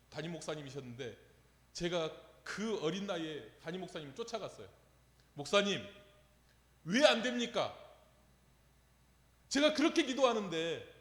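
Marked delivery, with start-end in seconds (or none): 5.3-10.9 loud, high, pleading challenge to the senior pastor; demanding protest. Retold or imitated. Young preacher confronting his senior pastor, loud demanding protest. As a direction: push back hard, voice rising to a shout. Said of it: "하면서 막 따졌어요".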